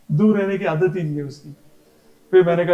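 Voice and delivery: usual speaking voice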